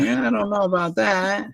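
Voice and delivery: high, drawn-out skeptical reply; mock doubt. Quoting own doubtful reply to wife.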